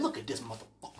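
a low disgusted aside; muttered aside. Retold or imitated. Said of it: "I said".

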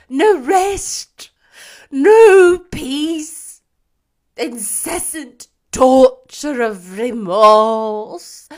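high, loud tormented cry; anguished ghostly wail. A narrator's reading. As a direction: cry out in endless remorse.

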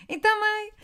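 pretending to be her twin on the phone, high and hysterical; put-on sister voice. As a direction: squeal it like an overexcited daughter. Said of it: "mudei a minha voz".

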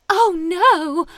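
high and loud, hammed-up panic; squealed mock dismay; mock alarmed cry. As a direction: yelp it like a startled fairy. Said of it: "and she's like".

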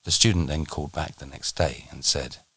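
ordinary talk

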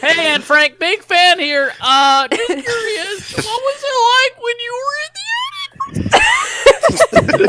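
high, slowing, pleading mock fan. A podcaster mock-asks Anne Frank as a fan, high and drawn out. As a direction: ask like an awestruck fanboy, hammed up.

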